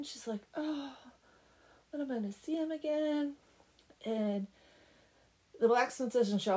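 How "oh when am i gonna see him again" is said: higher-pitched lovelorn sigh; mock forlorn. Oksana missing the blacksmith, mock forlorn. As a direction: pine after him in a higher voice. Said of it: "Oksana's all forlorn".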